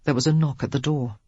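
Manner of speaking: ordinary delivery, nothing marked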